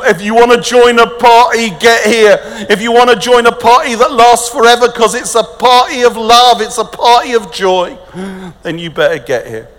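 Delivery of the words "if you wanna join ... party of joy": high, loud, rousing invitation; preaching at full force. Real, not acted. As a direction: call the crowd to a party.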